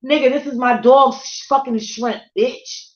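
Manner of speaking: loud high angry shout at him; heated yell. Retold or imitated. Confronting the man who took her shrimp, loud angry shout. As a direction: bark it at the shrimp thief. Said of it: "I was fucking heated".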